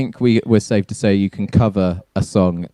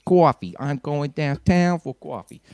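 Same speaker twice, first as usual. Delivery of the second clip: put-on Boston accent; 6 semitones higher than usual. Continues Boston bit after praise.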